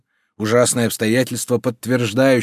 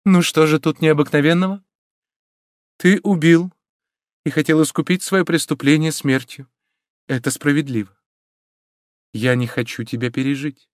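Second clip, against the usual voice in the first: raised, resolute voice easing down; forced firmness; 6 semitones higher than usual. A narrator's reading. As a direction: declare it firmly, then soften. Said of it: "которому она старалась придать твёрдость".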